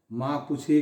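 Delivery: usual speaking voice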